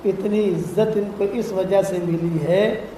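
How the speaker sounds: slow, hushed, low reply of Jibreel; slow solemn angel's answer. Retold or imitated. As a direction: draw each word out gently, like a revered messenger. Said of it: "نے کہا". (آہستہ، دھیمی اور گہری آواز میں جبریل کا جواب)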